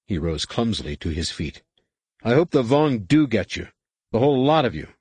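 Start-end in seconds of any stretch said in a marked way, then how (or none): 2.2-4.9 bitter curse. A narrator's reading. Ven curses them, rising.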